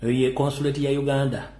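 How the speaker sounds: normal talking voice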